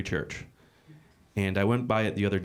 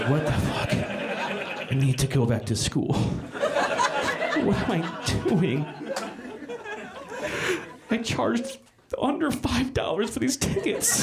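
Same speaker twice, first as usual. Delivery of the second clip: panicking comic; 10 semitones higher, slower than usual. Retold or imitated. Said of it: "Just like".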